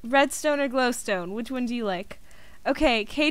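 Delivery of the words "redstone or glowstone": usual speaking voice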